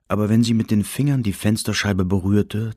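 ordinary delivery

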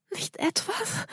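breathless frightened whisper; gasped whisper. Acted. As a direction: gasp it out in a whisper. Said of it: "keuchte sie".

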